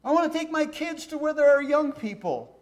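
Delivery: lightly voicing his grown kids making their case; mimicking grown kids. Preacher mimics his grown kids asking to leave, mild whiny voice.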